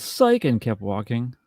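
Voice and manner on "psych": high-pitched retold yell; mock yell. Woman in the movie yelling to someone, mock yell. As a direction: call it out high, a little louder. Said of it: "she just yelled".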